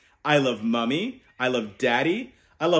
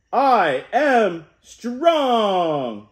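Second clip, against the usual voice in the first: very slow, loud, higher, stretched to the end; rousing shout; 5 semitones higher, 4 dB louder, much slower than usual. Shown as a demonstration. Uncle leading kids in a big shout. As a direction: belt it out so kids shout along.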